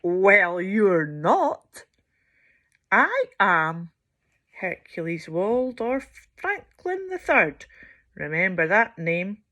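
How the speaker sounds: low, loud proud voice; pompous hippo. Acted. Hercules boasting to Sheila, low and loud. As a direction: boom with self-importance.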